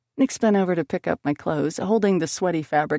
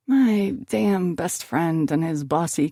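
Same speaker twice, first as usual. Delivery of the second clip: ordinary delivery, nothing marked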